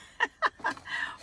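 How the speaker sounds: giggle. Real, not acted. Opening package in car.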